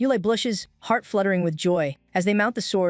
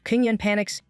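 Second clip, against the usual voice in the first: usual speaking voice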